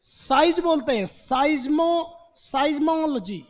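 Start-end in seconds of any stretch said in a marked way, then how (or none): 1.1-2.3 साइज्मो drawn out slowly, each syllable clear as sai-zmo; slow pronunciation demo. Shown as a demonstration. Teacher slowly sounding out साइज्मो for students. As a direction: spell out साइज्मो slowly for the class. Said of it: "पहले इसका pronunciation लिखो".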